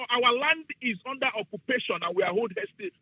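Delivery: normal talking voice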